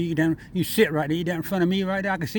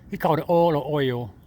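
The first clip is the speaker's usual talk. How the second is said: ordinary delivery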